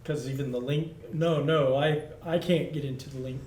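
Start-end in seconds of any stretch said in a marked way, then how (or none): none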